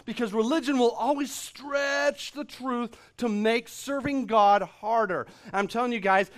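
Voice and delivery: full-force preaching. Acted. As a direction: drive the point home to the congregation. Preaching against religion's handcuffs.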